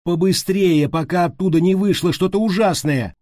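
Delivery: high, loud alarmed shouting; urgent cry, pitched far up. A narrator's reading. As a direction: yell a frantic warning to hurry. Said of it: "крикнула Стеф".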